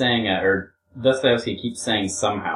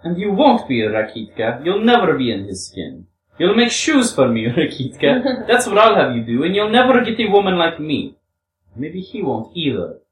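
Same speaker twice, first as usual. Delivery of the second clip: high, loud, taunting character voice; scornful reply, pitched up and lively; Grushenka; 6 semitones higher, 4 dB louder than usual. Acted. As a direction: needle him in a raised woman's voice.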